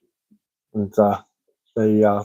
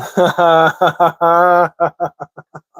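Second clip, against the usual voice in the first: hearty laugh; 6 semitones higher, 3 dB louder than usual. Real, not acted. Laughing at joke.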